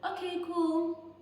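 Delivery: slow and high, dripping with irony; mock-cheerful "okay, cool" to the viewers; sarcastic okay cool. Real, not acted. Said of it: "sarcastic aside to viewers".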